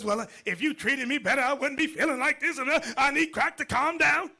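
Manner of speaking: high, defiant mock wife. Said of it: "So she says".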